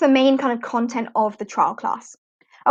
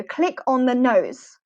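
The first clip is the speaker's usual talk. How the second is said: slow, clear "Click on the nose"; slow teacher instruction; much slower than usual. Shown as a demonstration. Teacher giving a child a slow, clear instruction. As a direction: spell it out slowly for a young learner. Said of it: "you can then say to the student".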